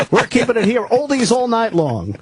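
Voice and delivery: upbeat overnight DJ promo read; radio DJ voice. Shown as a demonstration. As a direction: sell the morning show like a DJ. Closes DJ promo demo.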